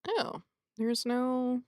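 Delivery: usual speaking voice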